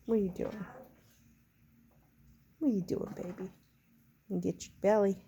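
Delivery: usual speaking voice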